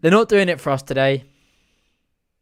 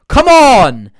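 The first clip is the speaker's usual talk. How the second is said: loud, high drawn-out yell; urging shout; 9 semitones higher, 13 dB louder than usual. Real, not acted. Fan to the team on the attack, urging shout. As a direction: roar the team forward, pitch up.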